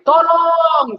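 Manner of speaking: long, high-pitched call for help; retold cry for help. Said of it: "saya berteriak".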